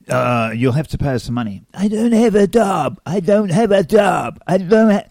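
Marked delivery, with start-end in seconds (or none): 1.7-5.0 high, loud, slow mock wail; hammed-up childish protest, drawn out and loud; mock distressed victim. Pretend victim refusing to pay the scammer, mock wail.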